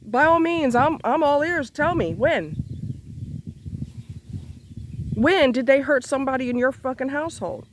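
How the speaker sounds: high, heated demanding; angry. Real, not acted. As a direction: challenge them, furious and high. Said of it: "I'm cussing 'cause I'm angry".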